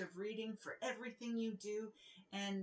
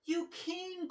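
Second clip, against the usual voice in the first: higher, delighted exclamation; glad cry; 8 semitones higher than usual. Acted. Ogre greeting the princess at his door, glad high cry. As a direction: burst out happily at the door. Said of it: "cried the ogre".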